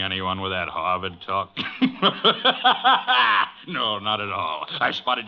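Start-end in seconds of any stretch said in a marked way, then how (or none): 1.6-3.6 mocking laugh. Laughs at the sharpers.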